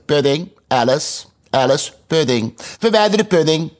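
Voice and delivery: sour, irritated royal command; sour grumble. Acted. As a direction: snap the order at the waiters. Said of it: "keek zuur en gromde".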